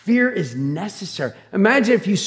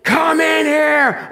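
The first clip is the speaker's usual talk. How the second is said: high, slow, full shout; yelled command; 10 semitones higher, 5 dB louder, much slower than usual. Retold or imitated. Preacher reenacting yelling at the boatman, loud high drawn-out shout. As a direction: shout it across water, stretching each word. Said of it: "I'm yelling at him".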